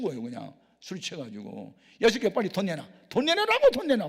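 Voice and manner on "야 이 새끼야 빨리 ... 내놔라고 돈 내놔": put-on high, loud drunken shouting; drunken yell. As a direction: scream for the money, much higher and louder. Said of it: "막 그래".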